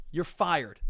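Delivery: ordinary talk, nothing marked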